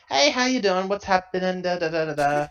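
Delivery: high, loud breezy hello home; cheery personal message. Pretend. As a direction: chirp it out like a carefree postcard.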